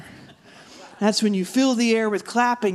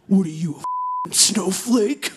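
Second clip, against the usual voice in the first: slow, sneering edgelord parody; edgelord comic; slower than usual. Acted. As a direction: taunt slowly as an edgy comic. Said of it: "I will now demonstrate for you. The edgelord".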